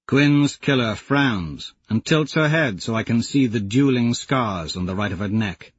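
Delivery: ordinary talk, nothing marked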